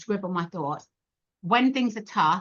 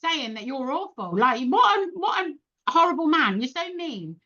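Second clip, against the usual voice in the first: mock telling-off; 7 semitones higher than usual. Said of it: "I said".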